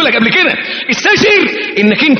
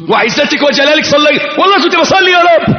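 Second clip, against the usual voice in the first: startled pleading; 9 semitones higher than usual. Acted. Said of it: "تتفزع، تقول".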